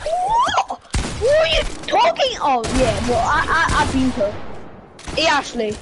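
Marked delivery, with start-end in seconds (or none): 0.0-2.4 high, shouted disbelief; outraged shout. Real, not acted. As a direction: yell it in shock. Accused of kill stealing.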